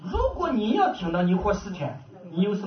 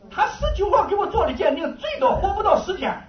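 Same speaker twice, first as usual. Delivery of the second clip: laughing disbelief; 9 semitones higher than usual. Retold or imitated. Laughing at doctor's delay.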